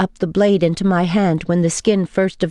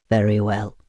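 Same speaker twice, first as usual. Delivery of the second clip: steady and courteous, low; calm vampire; 10 semitones lower than usual. A narrator's reading. Vampire asks her to cut him quickly, calmly. Constantine's voice.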